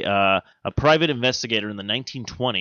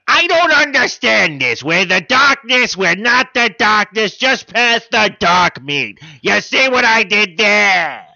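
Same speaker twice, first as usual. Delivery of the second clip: high, loud, slow goofy pun delivery; hammed-up demon dad; 11 semitones higher, 9 dB louder than usual. Demon family at Thanksgiving, hammed-up high voice. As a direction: ham up the bad joke. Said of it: "demon family dinner parody".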